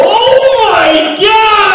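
high, slow, loud shout from downstairs; dad's shocked yell from downstairs. Retold or imitated. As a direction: bellow it up the stairs like a stunned dad. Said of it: "I hear my dad ... go".

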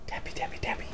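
teasing whisper to the dog. Acted. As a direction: whisper it playfully, building excitement.

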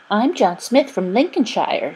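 singsong mockery; mocking repetition. A narrator's reading. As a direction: make fun of him in a put-on voice. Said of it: "making fun of him".

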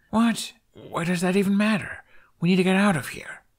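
high, urgent alarmed voice; alarmed urging. Acted. Hiccup to his dragon, surrounded by warriors, alarmed. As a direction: push to get out, rattled.

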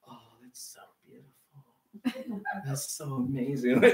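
hushed, gushing onlookers; mock gushing whisper. Preacher mocks the congregation cooing over the baptism, whispered. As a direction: whisper it like moved churchgoers.

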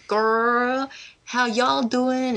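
slow, hammed-up American "y'all" greeting; bad American accent. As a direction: imitate her American accent, badly faked. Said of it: "Her accent especially".

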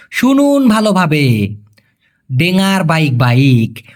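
slow teaching delivery, each word apart; careful slow demo; slow and clear. Shown as a demonstration. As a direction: say it slowly so learners catch ডেঙ্গার bike bike. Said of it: "ঠিকঠাক মতো শুনুন".

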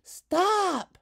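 put-on high, loud childish shout. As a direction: cry out to stop them. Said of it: "Hazel shouted".

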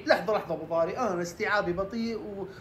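a hint of a confused follower. Viewer interrupts the expert.

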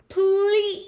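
high, loud, drawn-out plea; begging. A narrator's reading. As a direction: beg with one long, stretched please. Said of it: "begged the bird".

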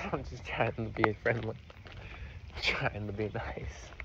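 quietly sincere; soft earnest. Real, not acted. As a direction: sound harmless. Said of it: "You're scary".

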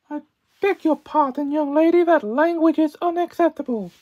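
loud, prim scolding voice; prim schoolmarm. Acted. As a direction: scold like a strict governess. Scolding voice after "bask.

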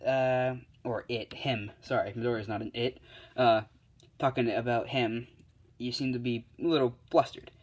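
ordinary delivery

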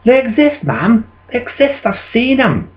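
high-pitched, earnest protest; insistent young believer. Acted. Child telling mother dragons exist, high insistence.

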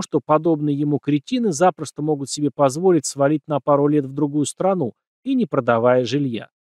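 usual speaking voice

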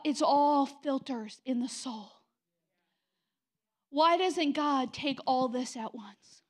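ordinary talk

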